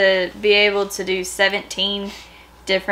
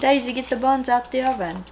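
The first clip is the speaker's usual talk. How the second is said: put-on British accent, pitched a little high; mimicked British accent; 4 semitones higher than usual. Viewer playfully tries a line after watching British accents, mimicked British accent.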